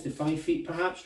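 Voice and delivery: usual speaking voice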